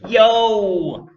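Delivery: high, loud, drawn-out American holler; silly American accent. A narrator's reading. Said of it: "in a silly American accent".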